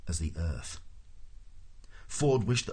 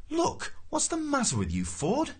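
high-pitched, bewildered question; exasperated demand. A narrator's reading. Arthur demands an explanation.

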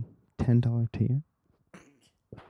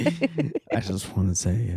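put-on persuasive old man voice; 3 semitones lower, 4 dB quieter, slower than usual. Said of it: "persuasive, old man thing to do".

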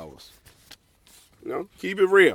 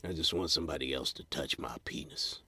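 lonely mutter; 9 semitones lower, 12 dB quieter than usual. Retold or imitated. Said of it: "and got myself".

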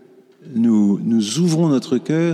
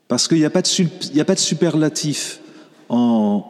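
normal talking voice

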